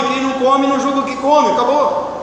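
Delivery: ordinary talk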